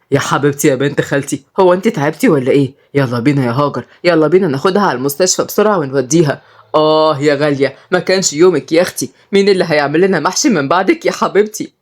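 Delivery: ordinary delivery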